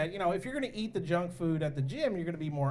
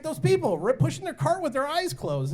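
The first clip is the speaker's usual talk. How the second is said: put-on high, raised child's complaint; imitated indignant kid; 9 semitones higher, 5 dB louder than usual. Continues acting his indignant kid.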